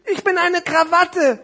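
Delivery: high, loud, slow announcement; stretched-out high puppet voice; necktie in a story. Acted. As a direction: declare it in a tiny character's voice. Small parcel reveals itself.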